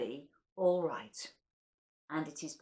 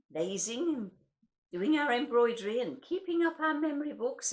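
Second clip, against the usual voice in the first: wistful, lilting recital with higher lines; poem recital; 9 semitones higher, 5 dB louder than usual. Acted. Reading Joyce Grenfell's Time to listeners, wistful recital. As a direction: let the memories swell then fade.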